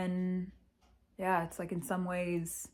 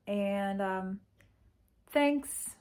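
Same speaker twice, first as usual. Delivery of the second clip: ordinary talk, nothing marked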